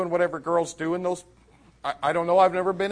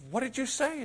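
high-pitched, eager question; amazed question; 10 semitones higher than usual. Retold or imitated. Preacher asking girl what she said at the door, amazed. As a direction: ask it high, wanting the story.

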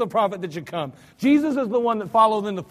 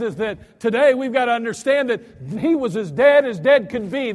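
ordinary talk, nothing marked

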